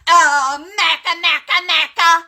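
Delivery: high sing-song jeer of the name; mocking name call. Mocking her rival on the live stream in a high sing-song. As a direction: tease the name high and loud.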